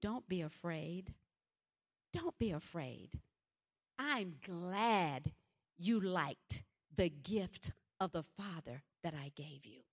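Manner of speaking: low, soft, slow fatherly reassurance; comforting voice of God. Retold or imitated. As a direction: speak gently as God calming a frightened child. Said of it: "a voice said to me".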